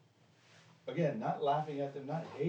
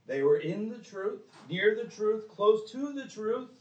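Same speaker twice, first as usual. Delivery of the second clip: rising warning; 8 semitones higher, 5 dB louder than usual. Real, not acted. Building warning climax.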